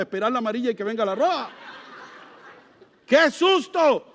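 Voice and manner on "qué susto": slow, loud and high mock scare; mock fright shout. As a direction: ham up the fright of being caught. Describe el susto ante la policía. (grito exagerado de susto fingido)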